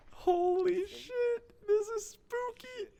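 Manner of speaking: drawn-out stunned gasp; awed. Real, not acted. Stunned by fighting.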